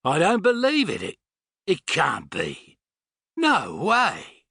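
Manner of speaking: high astonished Australian outburst, then slowing; stunned disbelief. A narrator's reading. Older Australian peering through binoculars, stunned and disbelieving.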